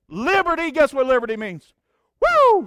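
soaring high, loud preacher's cry; excited whoop. Real, not acted. Pastor rousing the congregation over liberty, whooping. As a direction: lift the church with a shout.

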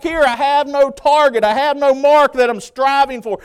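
loud, high-pitched sermon delivery; full-force preaching. Real, not acted. Preacher urging church toward the mark, full-force preaching.

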